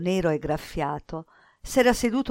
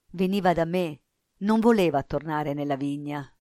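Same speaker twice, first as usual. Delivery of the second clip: ordinary delivery, nothing marked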